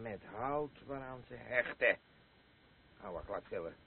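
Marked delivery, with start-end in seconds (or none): none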